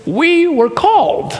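father's answer. Retold or imitated. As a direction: quote the father's simple answer slowly. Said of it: "his answer is always".

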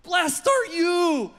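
high, loud, slow excited blessing; excited praise. Acted. Jesus praising Peter, high and excited. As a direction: burst out with joy, stretching the words. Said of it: "He was so excited".

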